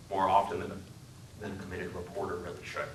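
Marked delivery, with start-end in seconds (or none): none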